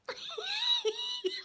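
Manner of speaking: very high crying laugh; high squeal of laughter. Shown as a demonstration. Showing how she lost it at the funeral, high crying laugh. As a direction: shriek with helpless laughter. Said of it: "Like that".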